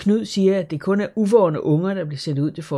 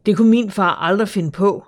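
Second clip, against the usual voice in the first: louder, indignant defence; angry; 4 semitones higher, 7 dB louder than usual. A narrator's reading. Karen angrily defending her father to Asta. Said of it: "siger Karen vredt".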